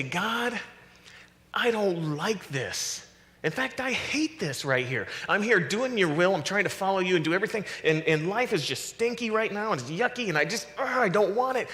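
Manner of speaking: acted-out fed-up complaint; complaining to God. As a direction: whine it out as a frustrated protest to God. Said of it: "he complains to God".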